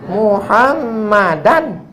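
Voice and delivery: high, drawn-out Muhammadan ending in -an; slow teacher's demo of Muhammadan; Arabic case ending. Shown as a demonstration. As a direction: stretch Muhammadan slowly, sounding the -an ending.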